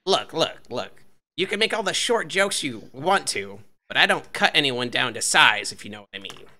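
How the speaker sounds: high-voiced little bookie making his case; halfling bookie defending himself. Halfling bookie answers short jibes.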